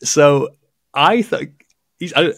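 usual speaking voice

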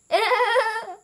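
high, loud giggle; giggling. A narrator's reading. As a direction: giggle like amused elephants. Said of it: "giggled again".

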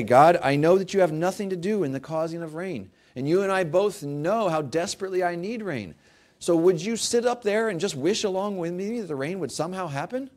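mock pleading prayer, sarcastic. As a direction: pray it with heavy sarcasm. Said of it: "you know I'm being sarcastic".

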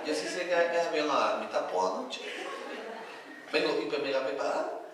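lightly voiced posh girl, low and soft. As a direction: play a posh girl, voice dropped. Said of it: "atrás tenía yo a dos pijas". (voz de pija, baja y suave)

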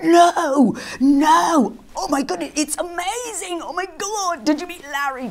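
imitating friends, high and gushing; mock-squeal like starstruck fans; hammed-up breathless excitement at meeting Larry; gushing amazement. Imitating people's excited reaction.

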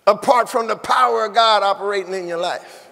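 ordinary delivery

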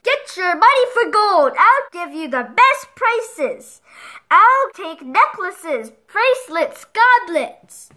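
put-on old stallholder crying his trade; market trader calling. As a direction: call out to the passing crowd. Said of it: "An old man sat behind the counter calling".

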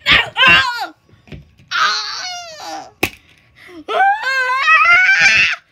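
loud, high drawn-out wails; panicked screams. Pretend. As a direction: scream in hammed-up terror. Screaming under attack.

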